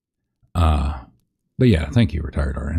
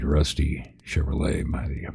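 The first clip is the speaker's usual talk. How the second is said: whispering in your ear; 6 dB quieter than usual. Real, not acted. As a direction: close soft whisper. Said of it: "Just let me keep whispering in your ear".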